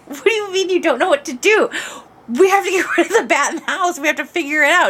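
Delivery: incredulous, half laughing; laughing exasperation. Retold or imitated. To a husband who doesn't know what to do, laughing exasperation.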